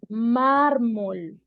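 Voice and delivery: slow "mármol" with the first syllable MÁR pushed loud; stress on first syllable. Shown as a demonstration. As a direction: drag out "mármol", leaning hard on MÁR. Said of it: "estamos acentuando más la primera sílaba".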